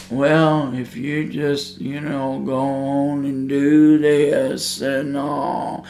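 soft, slow, indifferent shrug of a line; without vehemency. Shown as a demonstration. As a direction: say it like you barely care, quiet and slow. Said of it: "I don't tell you with enough vehemency in my voice".

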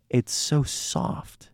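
hushed, slow, almost breathed; soft and slow. Real, not acted. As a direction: say it gently, stretching it out. Describing the soft orange flavor.

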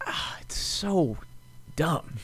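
ordinary talk, nothing marked

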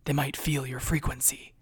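hushed, calm whispered warning. A narrator's reading. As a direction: whisper gently so no one hears. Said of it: "she asked calmly and quietly".

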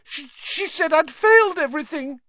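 put-on girl gasping in panic after the boggart; upset, gasping. A narrator's reading. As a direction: blurt it out shaken and tearful. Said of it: "Hermione gasped".

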